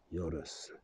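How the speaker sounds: Uranus said softly as YOR-us; Uranus, short version. Shown as a demonstration. Showing how people say it, Uranus as YOR-us. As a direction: squash Uranus down, stress on YOR. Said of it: "'cause people say it how they want".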